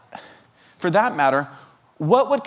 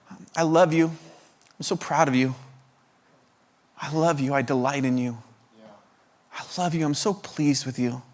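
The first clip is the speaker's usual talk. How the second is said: tender fatherly love; 3 dB quieter than usual. Retold or imitated. Father's tender words voiced.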